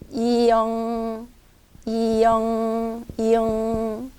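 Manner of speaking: 이응 said slowly with a nasal ng; 이응 drawn out, tongue pulled back; 이응 또박또박 천천히; ieung pronounced. Shown as a demonstration. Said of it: "이 발음이 좀 빨리 하시면 돼요".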